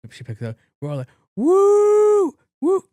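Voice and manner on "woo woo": loud, very high whoop; cheering. Retold or imitated. Friends cheering behind the phone call, loud whooping. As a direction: let out big party whoops. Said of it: "is us cheering".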